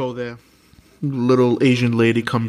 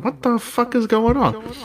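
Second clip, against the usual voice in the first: retold shock; 10 semitones higher than usual. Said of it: "I'm like".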